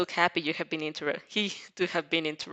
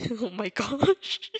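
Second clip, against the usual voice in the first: laughing; 3 semitones higher, 4 dB louder, much slower than usual. Real, not acted. Reader laughs at the story.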